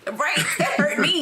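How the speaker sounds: laughing while protesting. Real, not acted. Laughing at gross example.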